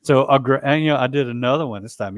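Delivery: ordinary delivery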